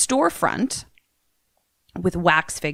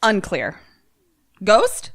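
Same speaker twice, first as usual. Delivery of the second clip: ordinary talk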